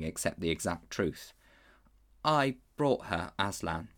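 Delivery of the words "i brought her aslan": ordinary delivery, nothing marked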